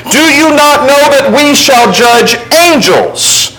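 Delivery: rising emphatic sermon reading; preaching scripture reading. Acted. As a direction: declaim the verses like Paul scolding Corinth. Reads Paul's rebuke aloud.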